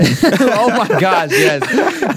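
high, drawn-out words through laughter; cracking up in agreement; helpless high-pitched laughing; real laughter. Real, not acted. Cracking up at the joke.

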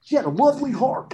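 high-pitched goofy celebrity impersonation; high, swooping impersonation; Andy Samberg. Acted. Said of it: "I do an Andy Samberg impersonation".

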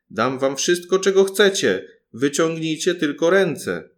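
loud, high calling out; loudest shout. A narrator's reading. Paluszek shouts from the room so the cook hears, loud shout. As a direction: yell it as loud as he can. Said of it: "zawołał jeszcze raz, jak mógł, najgłośniej".

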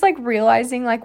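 usual speaking voice

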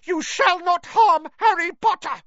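high, loud squeaky shout; elf shout. Acted. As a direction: shout it to protect him. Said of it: "But Dobby shouted".

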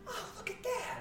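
tiny high-pitched soft exclamation; astonished bystander. Acted. Onlooker spots writing in the sky, high hushed amazement. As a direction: voice an onlooker in a high, small squeal.

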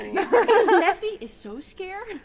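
very slow with a laugh. Shown as a demonstration. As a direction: stretch every word out, laughing. Example sentence, drawn out laughing.

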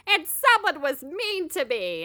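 hint of a whiny boyfriend; lightly whiny, slow complaint; whiny Ben impression. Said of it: "being like".

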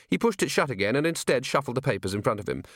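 usual speaking voice

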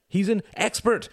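high, loud, urgent shout. A narrator's reading. Tom shouting in his brother's defence, shouting. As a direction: shout it out, pitched right up. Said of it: "shouted Tom".

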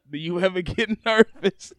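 breaks into a laugh mid-question. Real, not acted. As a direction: read it while cracking up. Reading question, cracking up.

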